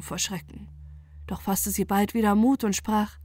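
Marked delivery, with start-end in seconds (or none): none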